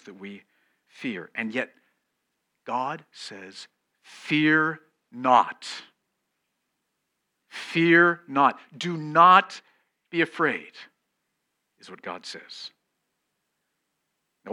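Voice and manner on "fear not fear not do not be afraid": preaching command. Acted. Preacher proclaiming God's command.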